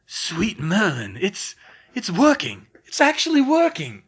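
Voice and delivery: building from awed to ecstatic; exclaiming. Acted. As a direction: burst out in disbelieving joy. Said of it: "he exclaimed".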